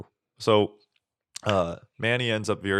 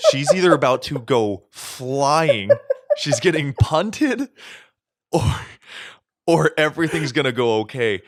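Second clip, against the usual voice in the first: tense; 5 semitones higher, 7 dB louder than usual. Retold or imitated. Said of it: "my heart stops".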